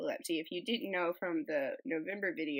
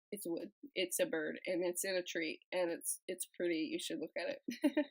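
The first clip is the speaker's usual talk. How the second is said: ordinary delivery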